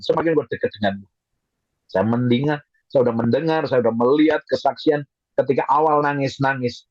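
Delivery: normal talking voice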